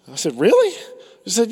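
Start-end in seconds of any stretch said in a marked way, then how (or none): 0.4-0.7 high squeaky Really; mock surprise. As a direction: leap way up in disbelief. Teller reacting in story.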